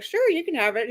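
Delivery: bright, high, overly polite offer; sweetly accommodating, exaggerated courtesy. Man hands the sign to the visitors, overly polite. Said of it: "exceptionally polite".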